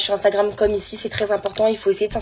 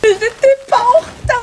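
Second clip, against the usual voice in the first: high, slow, louder crying whine; comic tearful protest; mock crying protest; 13 semitones higher, 4 dB louder than usual. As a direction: cry it out much higher.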